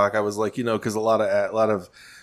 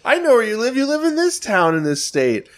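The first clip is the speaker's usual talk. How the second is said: mock internet threat; 13 semitones higher than usual. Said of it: "somebody's like".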